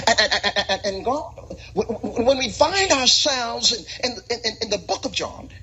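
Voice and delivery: high, stammering mock pulpit delivery; stuttering British-anointed preacher. Southerner suddenly in the pulpit, stuttering with a British anointing. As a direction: stutter grandly into each phrase like an affected preacher. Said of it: "a British anointing".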